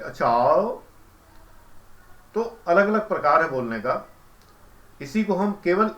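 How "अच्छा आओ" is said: comic drawn-out "अच्छा आओ" with a rising end; Utpal Dutt. Retold or imitated. Teacher imitating a film comedian's funny word, drawn-out rising "अच्छा आओ". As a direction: stretch "अच्छा आओ" and swoop it upward. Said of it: "Utpal Dutt जब अच्छा बोलते थे".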